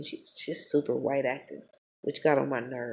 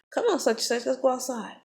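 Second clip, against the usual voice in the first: higher, gently coaxing invite; coaxing the kid; 9 semitones higher than usual. Retold or imitated. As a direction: sweet-talk the boy into going outside. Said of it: "So I looked at him and I said".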